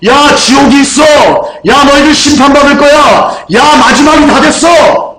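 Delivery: a prophet shouting warnings of hell to the people; bold proclamation. Retold or imitated. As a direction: proclaim it boldly to the crowd, full voice. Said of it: "당당하게 선포하셨습니다".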